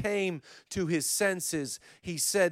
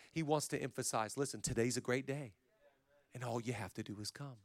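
much softer and lower, close and gentle; hushed preaching; 5 semitones lower, 8 dB quieter than usual. Acted. Telling listeners God is not rushing them, soft and low. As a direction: drop way down and draw them in. Hushed intimate stretch of sermon.

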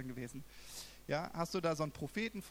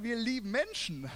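heart's declaration; 7 semitones higher, 6 dB louder than usual. Retold or imitated. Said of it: "dass mein Herz sofort gesagt hat".

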